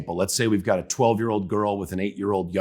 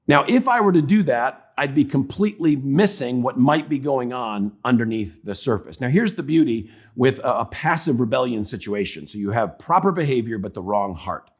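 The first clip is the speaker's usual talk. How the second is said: ordinary talk, nothing marked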